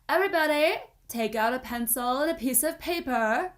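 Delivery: teacher announcing a test; teacher calling class. Acted. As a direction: call the class to order.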